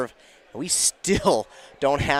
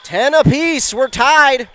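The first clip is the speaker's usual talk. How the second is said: high, loud, ringing tie call; excited score announcement; 7 semitones higher, 4 dB louder than usual. Real, not acted. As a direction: announce it loudly, pitched right up.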